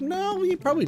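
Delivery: high dismissive quote; high quoted self. Retold or imitated. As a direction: pitch it way up, brushing it off. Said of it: "And I was like".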